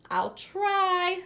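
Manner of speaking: high, bright smiling reply; smiling agreement. A narrator's reading. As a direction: agree with a big smile. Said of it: "said Tommy with a big smile".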